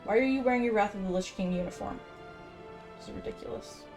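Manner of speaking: usual speaking voice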